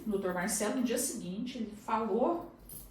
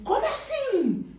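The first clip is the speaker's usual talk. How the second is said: much higher, slow astonished cry; stunned how come; 8 dB louder, slower than usual. Retold or imitated. Almir hearing he'll be a father, stunned and high. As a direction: disbelief rising way up.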